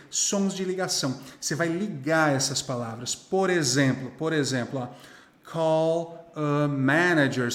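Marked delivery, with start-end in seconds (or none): 5.5-7.5 slow model phrase. Shown as a demonstration. Slow take before linking the words.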